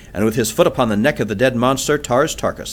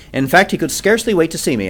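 plain reading voice

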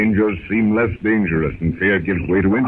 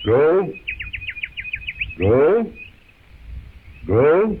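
puzzled Tarzan; 7 semitones higher, much slower than usual. Acted.

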